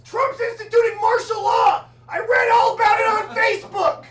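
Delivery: high, loud panicked shout; frantic neighbor yelling. Retold or imitated. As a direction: yell it like the world is ending. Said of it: "and he goes".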